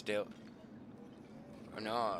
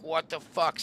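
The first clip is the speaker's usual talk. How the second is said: retold annoyance, higher and louder; pissed off; 7 semitones higher, 5 dB louder, slower than usual. Hunter finding the car gone, retold as pissed off. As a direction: replay your pissed-off disbelief. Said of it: "So now I'm pissed".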